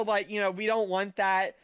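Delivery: usual speaking voice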